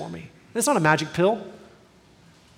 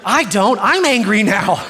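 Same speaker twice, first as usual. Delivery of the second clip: louder and higher, a husband getting worked up; hammed-up anger at the one who hurt her; half-joking angry flare-up; mock angry outburst; 4 semitones higher, 6 dB louder, slower than usual. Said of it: "I'm like".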